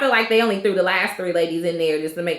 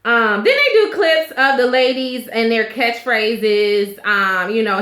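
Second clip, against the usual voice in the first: ordinary talk, nothing marked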